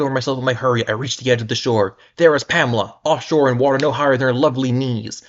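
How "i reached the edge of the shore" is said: plain reading voice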